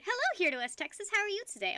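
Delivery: imitating the neighbour's perfect English greeting; mock refined English gentleman; posh English voice. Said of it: "the most immaculate English voice I'd ever heard".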